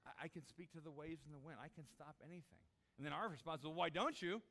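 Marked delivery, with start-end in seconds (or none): 0.0-2.6 soft, intimate questioning; quiet directness. Acted. Jesus asks doubting followers to understand, quiet and direct. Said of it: "looks at us square in the eye". 3.7-4.4 loud, raised comeback; exasperated retort. Acted. People answer Jesus who can stop anything, loud retort.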